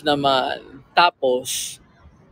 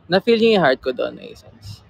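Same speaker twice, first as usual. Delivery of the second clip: ordinary talk, nothing marked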